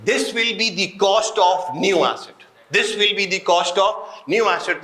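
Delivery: each word spelled out slowly for notes; slow dictation. Shown as a demonstration. Teacher slowly dictating the rule to students, word by word. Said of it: "slow dictation of the rule".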